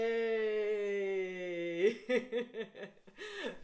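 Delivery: easy laugh after the cheer; cheerful laughter. Shown as a demonstration. Teacher wrapping up the chant: happy laughter. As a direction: laugh it off freely. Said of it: "And yay".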